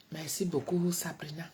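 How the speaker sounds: normal talking voice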